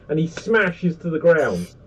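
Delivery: normal talking voice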